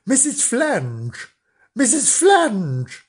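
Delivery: put-on slow sing-song call of children; children's slow sing-song call. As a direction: call out her name slowly like kids at the door. Children calling over the fence.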